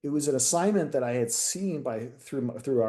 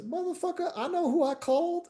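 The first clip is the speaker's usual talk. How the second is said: high, put-on voice, mock exasperated; sergeant's retort. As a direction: imitate the boss snapping back in a silly high voice. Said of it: "I'll use the language".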